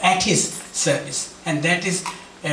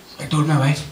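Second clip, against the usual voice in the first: ordinary talk, nothing marked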